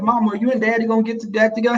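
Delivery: put-on high, quick kid's question; imitated childhood pestering; pleading child. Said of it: "I used to be like".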